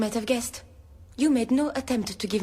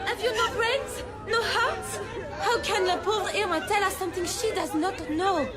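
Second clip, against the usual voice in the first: high, scornful rebuke; furious governess defending Irma; angry scolding; 9 semitones higher than usual. Acted. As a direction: scold them like fools.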